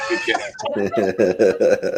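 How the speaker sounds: slow, stretched thanks with a laugh; drawn-out laughing shout. Real, not acted.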